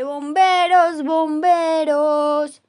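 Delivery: loud, very drawn-out call; shout. Acted. Mother yelling again for the firemen, loud and drawn out.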